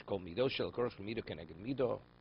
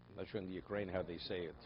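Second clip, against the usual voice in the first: usual speaking voice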